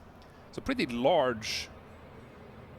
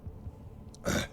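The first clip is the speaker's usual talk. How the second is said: real harsh cough from pepper heat; coughing fit; 7 dB quieter than usual. Real, not acted. A taster coughs after biting a habanero, real cough. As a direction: cough through the burn.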